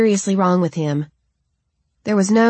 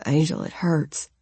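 softer, pained, close to tears; near sob; 5 dB quieter than usual. A narrator's reading. Philip admits his pain to Angel, softly near tears. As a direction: hold back a sob on it. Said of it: "a breath that verged on a sob".

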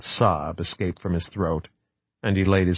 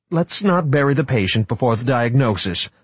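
forced cheer, pitched higher; desperately bright; 6 semitones higher, 3 dB louder than usual. Acted. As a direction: sell the hope, too brightly. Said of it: "in a desperately bright voice".

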